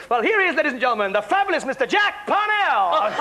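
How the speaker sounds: big, high showbiz build-up to a name; announcer introduction. Acted. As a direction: announce the star, rising to a hammed-up finish. Retold cabaret introduction of the star.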